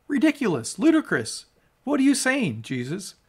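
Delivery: high, incredulous scoff; mock the claim as absurd; scoffing disbelief.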